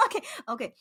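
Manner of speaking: normal talking voice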